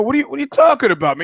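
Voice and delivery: high, fast, incredulous; baffled disbelief. Retold or imitated. Retold baffled reply to local.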